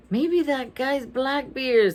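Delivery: ordinary delivery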